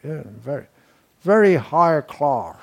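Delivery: hammed-up upper-class British accent; mock British accent. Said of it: "which I'm trying to imitate".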